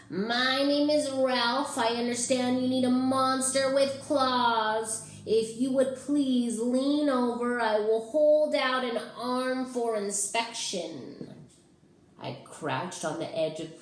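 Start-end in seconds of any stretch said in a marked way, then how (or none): 0.1-11.4 smooth and high, like a groomed dandy; Ralph's high silky voice. A narrator's reading. Ralph offers his claws to the kid, high and silky. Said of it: "in a high, silky voice".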